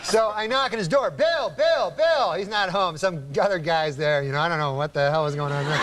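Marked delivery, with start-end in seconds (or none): 1.1-2.3 calling out. Retold or imitated. Man calling his neighbor at the door, raised voice. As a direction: knock and call for Bill, rising each time.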